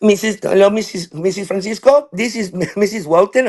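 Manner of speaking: high, put-on teacher on the phone; science teacher. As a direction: imitate a prim caller to a parent. She just calls.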